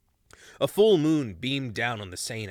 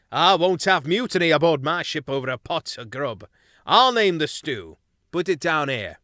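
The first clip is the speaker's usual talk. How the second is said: louder firm captain mouse; captain ordering his crew; stern captain; 5 semitones higher, 8 dB louder than usual. Acted. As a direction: settle it with authority. Luke takes command.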